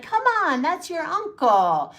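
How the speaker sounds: high, loud mock coaxing; mock family pressure. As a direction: pester like pushy relatives, drawn out at the end. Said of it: "and everyone's like".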